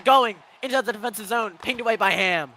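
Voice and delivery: fast live hockey commentary; play-by-play. Real, not acted. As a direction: call the action as it happens.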